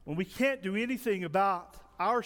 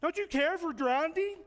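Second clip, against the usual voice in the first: high-pitched whiny complaint; whiny, accusing question pitched way up; whining; 9 semitones higher than usual. Acted. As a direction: plead like you've been ignored. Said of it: "start whining to Jesus".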